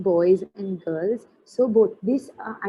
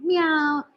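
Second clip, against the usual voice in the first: higher playful meow; cat meow; 7 semitones higher, 3 dB louder than usual. Shown as a demonstration. Teacher demoing the cat sound for children, playful meow. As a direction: mew like a kitten for the class. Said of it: "What sound did the cat make".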